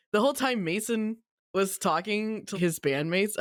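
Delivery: ordinary delivery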